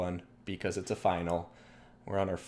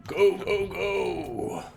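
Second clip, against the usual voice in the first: high, loud gung-ho soldier yell; StarCraft Marine voice; 11 semitones higher, 4 dB louder, much slower than usual. Pretend. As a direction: bark orders like a space marine. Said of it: "That's like my StarCraft Marine voice".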